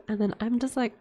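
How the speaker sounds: ordinary talk, nothing marked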